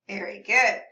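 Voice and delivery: ordinary delivery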